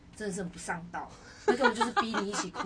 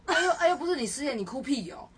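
higher, louder mocking coworker; teasing coworker; 6 semitones higher, 5 dB louder than usual. Coworker teasing her for crying, lightly voiced mock. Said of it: "他说".

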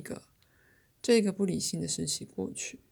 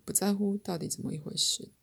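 usual speaking voice

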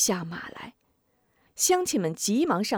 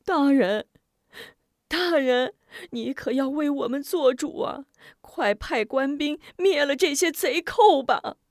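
higher crying plea; tearful begging; 6 semitones higher than usual. Acted. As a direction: beg the magistrate on their knees. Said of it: "哭求". (哭着哀求大人)

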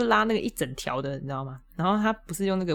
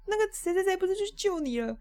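much higher, offhand coworker voice; careless coworkers. Retold or imitated. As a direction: toss it off breezily. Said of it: "他们就说".

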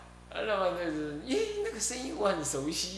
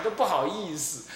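normal talking voice

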